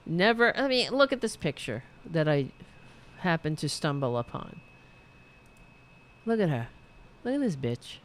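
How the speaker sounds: normal talking voice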